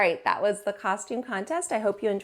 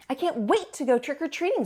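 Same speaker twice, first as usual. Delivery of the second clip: higher, eager little dog voice; eager kid voice; 7 semitones higher, 3 dB louder than usual. Acted. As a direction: bounce it like a child who can't wait. Cleo voiced in kids' story.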